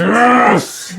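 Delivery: loud, high roared yes; battle shout. Acted. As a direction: roar it out as a fighting giant. Giant answers amid battle.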